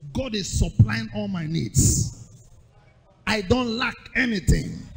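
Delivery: slow, loud faith declaration; slow loud confession of faith; bold declaration. Acted. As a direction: proclaim it over yourself.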